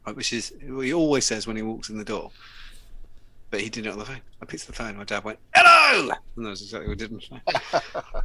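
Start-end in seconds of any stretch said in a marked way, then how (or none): 5.5-6.1 high, loud burst of hello; dad's loud hello. Retold or imitated. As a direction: blast out hello like dad on the phone. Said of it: "I do an impression of my dad".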